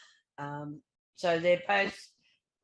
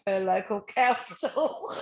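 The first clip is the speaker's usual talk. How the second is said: real laugh bursting through the words; breaking into laughter; 2 semitones higher, 3 dB louder than usual. Real, not acted. Co-host reading the per-council figure to Sue, breaking into laughter. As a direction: lose it laughing on the last words. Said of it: "I've got to laugh".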